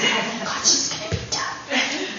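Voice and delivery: hushed, breathy, relieved aside; relieved guests. Retold or imitated. Guests relieved the speech is ending, hushed and breathy. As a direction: voice the crowd's relief under your breath. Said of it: "the look of relief on so many faces".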